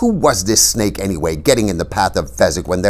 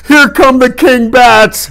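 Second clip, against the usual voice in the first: loud, high terrified scream; drawn-out frightened yell; Fezzik screaming; 10 dB louder, slower than usual. A narrator's reading. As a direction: scream it slowly, paralyzed with fear. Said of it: "Fezzik screamed".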